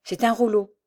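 a touch of an excited cry, building up; lightly voiced discovery, rising in wonder; a mild, narrated exclamation of finding gold; exclaiming. A narrator's reading. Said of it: "s'écria Michel".

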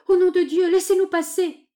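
high, loud plea; desperate high-pitched cry; urgent shout. A narrator's reading. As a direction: shout to be let through a crowd. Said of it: "lui cria-t-elle".

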